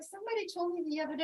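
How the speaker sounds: ordinary delivery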